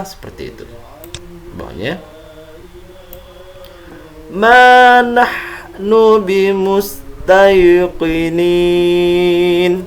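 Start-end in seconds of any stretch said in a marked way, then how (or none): 4.3-9.8 slow, careful Quran recitation; over enunciating. Shown as a demonstration. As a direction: chant each syllable deliberately. Teacher recites Quran verse slowly.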